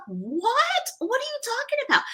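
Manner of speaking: very high, stretched "what?"; retold high-pitched incredulity; perplexed friend. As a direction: imitate a friend in disbelief. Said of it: "She was so perpl-, so perplexed".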